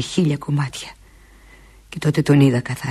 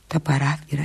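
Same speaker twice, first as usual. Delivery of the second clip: ordinary delivery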